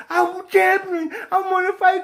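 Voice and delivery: slow high mock brag; mocking boxer. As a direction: mimic her as a dimwit bragging.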